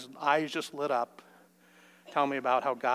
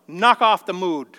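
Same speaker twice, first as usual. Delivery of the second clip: loud, slow, stern command; impatient parent scolding a moody child; harsh rebuke; 4 semitones higher, 13 dB louder, slower than usual. Retold or imitated. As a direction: bark it at the child.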